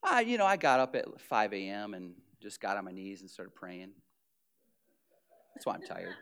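very low, soft, pious mumble; low, soft, false modesty; humble-brag. Retold or imitated. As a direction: slip the prayer in modestly. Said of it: "why are you tired".

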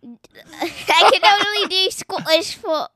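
loud, high, slow attempted Scottish accent. Shown as a demonstration. Child shows accent is back, loud Scottish accent. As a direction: lay on a playful Scottish accent. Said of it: "Okay, that it's back".

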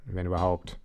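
usual speaking voice

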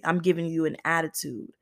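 ordinary delivery

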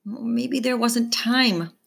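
slow, helpless; mother unsure and defeated; slow resigned mother. A narrator's reading. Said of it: "Mom says helplessly".

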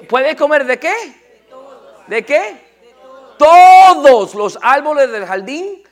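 high, loud question rising to a drawn-out shout; loud preaching. Real, not acted. Preacher quizzing the congregation on God's command, loud and emphatic. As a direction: build to a booming, stretched-out answer.

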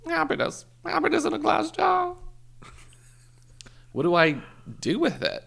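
high, loud, hammed-up old lady. Story clip: the lady answers the narrator in a loud, high hammed-up voice. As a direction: play the old lady selling happiness in jars.

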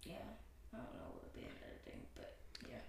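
fast breathy whispered aside; whispering. Real, not acted. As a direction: rush it under your breath. Hushed aside about editing.

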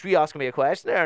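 retold boss snapping a question at the interviewee; mock indignant challenge. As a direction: fire it back like he's offended.

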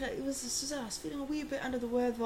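a hint of a gentle Englishman; British accent. Retelling an old British man about his flu, light British accent. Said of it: "his little accent".

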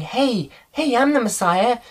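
high-pitched mock proclamation; hammed-up false prophet's claim; self-proclaimed messiah.

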